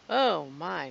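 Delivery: usual speaking voice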